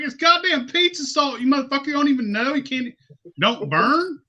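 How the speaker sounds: high-pitched exasperated reveal; retold incredulous outburst. Telling the dude it's pizza salt, incredulous outburst.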